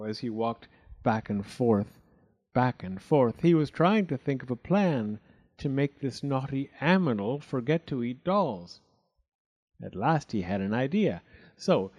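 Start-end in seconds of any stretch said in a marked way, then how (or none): none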